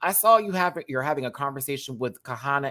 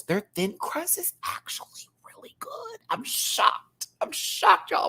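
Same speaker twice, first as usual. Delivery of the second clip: whispered, higher aside to chat; slower than usual. Real, not acted. Streamer whispers her pizza surprise to chat. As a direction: let it slip out in a hushed whisper.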